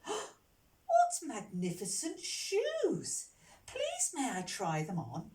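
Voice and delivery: high, drawn-out exclamation easing into a request; admiring delight. Acted. Dandy gentleman admiring the shoes in the shop, delighted. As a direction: gush slowly over something splendid.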